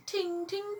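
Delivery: high, light ting ting. A narrator's reading. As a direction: tinkle it softly. The ladybird's wing sound.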